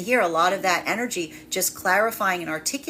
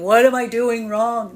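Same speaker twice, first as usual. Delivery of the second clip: higher, louder, slow plea of self-doubt; mock anxious "what am I doing wrong"; insecure pleading; 4 semitones higher, 7 dB louder, slower than usual. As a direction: ask it like someone blaming herself, drawn out. Voicing an insecure feminine energy.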